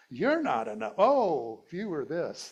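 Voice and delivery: loud, high mock accusation; mock blaming. Projecting not-enough onto whoever's in front, mock accusing. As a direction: burst out loud and high, acting out blame.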